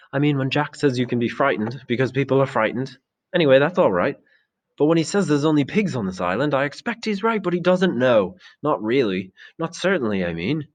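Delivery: put-on a boy reasoning aloud, lifted pitch; Maurice, earnest boy, unsure. Maurice arguing with the assembly about Jack's claim, earnest and unsure.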